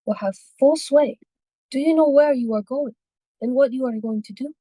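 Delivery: put-on high, urgent old lady warning; frightened old woman. Old lady warns of evil.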